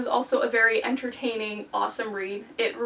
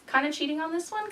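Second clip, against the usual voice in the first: ordinary talk, nothing marked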